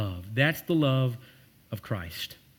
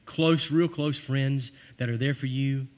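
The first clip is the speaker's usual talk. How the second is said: normal talking voice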